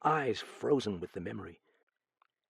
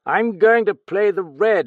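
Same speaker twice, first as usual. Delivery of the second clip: higher, louder excited cry; cried out; 10 semitones higher, 11 dB louder than usual. Acted. Raoul at the roulette table, crying out excitedly. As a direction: call it out like a lucky gambler. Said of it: "he cried".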